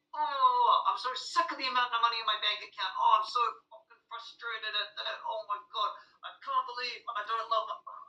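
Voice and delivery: hammed-up parent venting about money; imitating frustrated parent. Teacher to audience, acting a parent's frustrated rant about money. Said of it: "Here's what I got taught by a parent".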